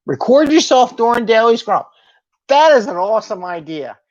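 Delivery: high, loud, over-energized voice; changed voice. Shown as a demonstration. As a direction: jump up into an excited high pitch.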